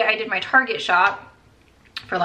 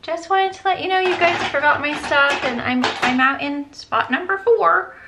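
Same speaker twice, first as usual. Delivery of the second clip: high, sugary customer-service tone; fake-nice call to the store, higher; mock polite phone voice; 7 semitones higher, 5 dB louder than usual. Customer calling the store in a higher mock-polite voice. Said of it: "I call them when I get there and I say".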